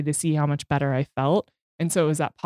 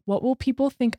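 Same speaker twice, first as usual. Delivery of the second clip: anxious worry; 7 semitones higher than usual. Retold or imitated. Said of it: "I'm like".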